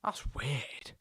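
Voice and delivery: whispered aside; hushed bemused remark. Real, not acted. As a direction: breathe it out as a whisper. Puzzled aside to self.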